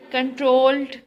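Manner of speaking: "Controlled" said loudly, its last syllable punched; "Controlled" with a strong, loud ending syllable; stress on last syllable. Shown as a demonstration. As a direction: lean hard on the second syllable of "Controlled". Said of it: "stress is on the last syllable".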